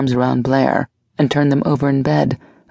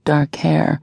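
usual speaking voice

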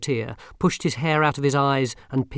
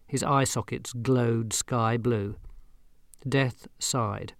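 ordinary delivery

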